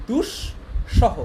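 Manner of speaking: দুঃসহ slowly split, dush-shoho, with sh; sharp sh pronunciation. Shown as a demonstration. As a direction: lean on the sh in দুঃসহ, syllable by syllable. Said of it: "তালব্য স দিয়ে".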